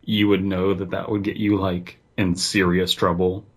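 ordinary talk

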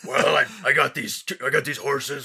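[name] voice. Retold or imitated. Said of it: "Is that [name] now?".

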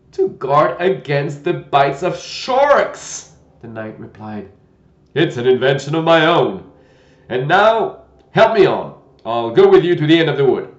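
put-on loud, gentle old knight; a kindly, bumbling old knight. Knight voiced in story.

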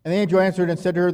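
normal talking voice

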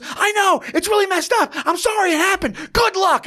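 high, squeaky flustered apology; mock freaked out. Retelling the freaked guy excusing his friend, high and flustered. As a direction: squeal it like a rattled man backing away. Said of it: "And they're freaking, he goes".

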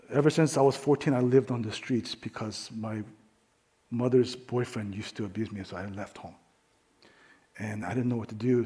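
very soft, low retelling, halting between phrases; imitated shaky testimony. Young woman testifying at the church mic, hushed and halting. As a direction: quietly voice a woman confessing her past. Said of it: "stuttering, she's, like, shaking".

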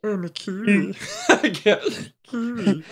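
laughing bad New Zealand accent. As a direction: try a Kiwi accent and crack up. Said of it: "with a New Zealand accent".

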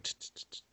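quick soft "tch" clicks; imitated stencil noise. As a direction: mimic stamping with short tongue-and-teeth bursts.

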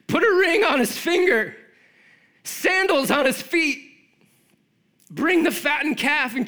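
high, loud, overjoyed father calling for a feast; the father calls the servants to celebrate, high and loud with joy; joyful father. Acted. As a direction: shout it out in pure joy as the father. Father joyfully welcomes son back.

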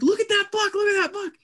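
put-on very high squeaky kid voice; imitated excited kid. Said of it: "The kid's going crazy".

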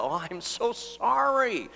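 high, drawn-out mock apology; acted apology. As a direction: apologise slowly in a high, sheepish voice.

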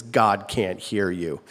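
low, slow retold scolding; stern father, voice dropped low and drawn out; mock stern yell. Dad scolding silent son in church, low mock-stern yell. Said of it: "he yells at him".

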